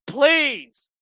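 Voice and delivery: ordinary talk, nothing marked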